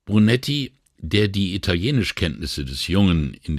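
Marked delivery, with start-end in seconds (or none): none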